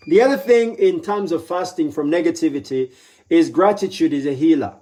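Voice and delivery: normal talking voice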